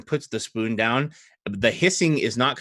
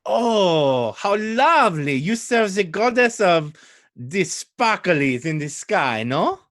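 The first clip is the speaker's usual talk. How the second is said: put-on delighted, high French-accented gush; 7 semitones higher, slower than usual. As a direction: coo admiringly in a high, heavy French accent. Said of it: "I don't know French at all".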